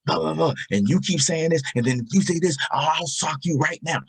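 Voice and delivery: high-pitched mock tirade of an angry boy; imitated angry hollering. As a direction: throw the voice up in a retold rant. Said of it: "he's screaming, he's hollering, he's throwing his voice".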